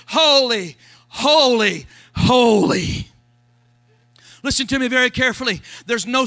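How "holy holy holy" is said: each holy louder, falling; emphatic triple holy; proclaimed holy. Retold or imitated. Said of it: "but they said".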